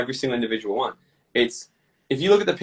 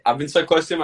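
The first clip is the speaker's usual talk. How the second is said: usual speaking voice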